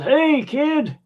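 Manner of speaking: high, hearty imitated call back; re-enacted high-pitched shout to a fan; quoted celebrity reply. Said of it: "And he goes".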